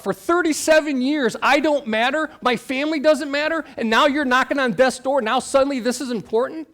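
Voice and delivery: high, loud, resentful inner outcry; bitter outburst. Retold or imitated. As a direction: fling the years of hurt back at him. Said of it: "I was so bitter".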